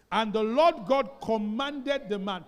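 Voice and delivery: loud, slow, measured recitation of scripture; scripture reading. Real, not acted. Pastor leading the church in reading Genesis, loud and slow. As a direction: lead the whole church through the verse.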